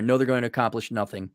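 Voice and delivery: usual speaking voice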